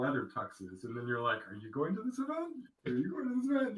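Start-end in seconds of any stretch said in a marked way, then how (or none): 1.5-3.8 high mock voice. Mock tux asking tuxes.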